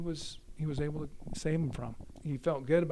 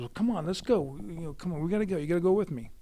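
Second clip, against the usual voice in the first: higher, louder coaxing; retold eager urging; 4 semitones higher, 5 dB louder, faster than usual. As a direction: imitate a buddy pressing a reluctant friend. Robert coaxes his friend, retold.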